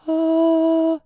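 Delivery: high breathy falsetto note; falsetto demo. Shown as a demonstration. Vocal coach demonstrating a breathy falsetto. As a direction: sing it light and airy in falsetto. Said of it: "falsetto sounds like this".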